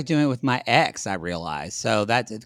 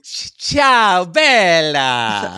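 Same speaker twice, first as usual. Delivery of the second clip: mock Italian greeting; 3 semitones higher, 8 dB louder, much slower than usual.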